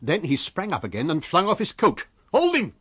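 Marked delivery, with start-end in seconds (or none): 2.3-2.7 high, urgent shouted order; high-pitched bellowed command; loud cry. A narrator's reading. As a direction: yell it to rally help. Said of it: "cried Jaffers loudly".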